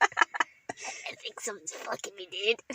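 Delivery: hushed, whispery send-up of a buddy; slow, soft, half-whispered mimicry; imitating a friend's voice. Friend mimicking his buddy in the woods, hushed impression. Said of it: "so easy to do his voice".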